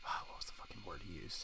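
a whispered, sighing aside to himself. Real, not acted. To himself, trying to recall the word, whispered. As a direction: mutter it under a sigh.

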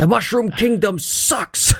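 high, slow, lightly done mock comedian rant; a badly faked grumpy comedian.

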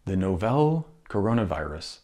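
"novel" said as NOH-vel, rhyming with "hotel"; "novel" mangled into NOH-vel like an unfamiliar word; mispronounced recording. Retold or imitated. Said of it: "not novel, novel".

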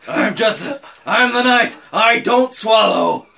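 loud, forced gravelly shout, slowing at the end; gravelly Batman voice. Pretend. Host parodying Batman's catchphrase, gravelly mock growl. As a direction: declaim it like a hammed-up growling superhero. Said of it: "with his gravelly voice".

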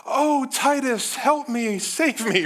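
high, drawn-out mock cry for rescue; dramatic little sister. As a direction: play a small girl hamming up her distress. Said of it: "totally dramatic".

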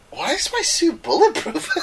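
very high, slow, laughing mock voice; imagined customer. Customer asking tailor: high laughing mock voice. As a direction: ham up a baffled customer.

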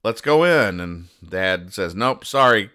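ordinary talk, nothing marked

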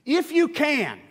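IF YOU CAN set apart, high and loud; pointed quote. Retold or imitated. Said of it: "You gotta notice this".

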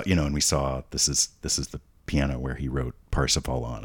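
ordinary talk, nothing marked

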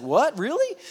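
high-pitched mock disbelief; astonished. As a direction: act amazed, voice leaping up. Balaam astonished by his talking donkey.

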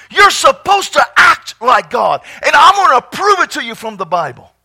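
loud, driving preacher's shout; full-force preaching. Acted. Pastor preaching to the congregation, full-force shouting.

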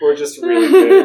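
high, loud, laughing boast. Real, not acted. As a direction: brag through a fit of laughter. Group cracking up at own joke.

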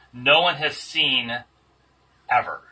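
slow, with "one" lifted and a long pause before "ever"; emphatic. Shown as a demonstration. Teacher repeating the Greek phrase to the class, slowly and emphatically. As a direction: drag "No one has seen" out, then drop "ever" on its own. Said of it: "So just emphatically saying that".

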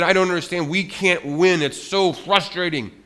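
hammed-up angry Moses for kids; exasperated cry of a man who can't win; frustrated Moses. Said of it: "He was so mad".

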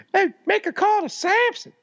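high squeaky rapper voice; Chappelle impression. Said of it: "I hope you're doing Chappelle".

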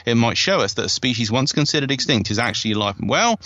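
ordinary talk, nothing marked

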